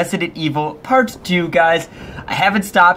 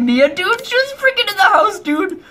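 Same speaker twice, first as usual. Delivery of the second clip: startled disbelief; 13 semitones higher than usual. Real, not acted. Startled by Mia appearing.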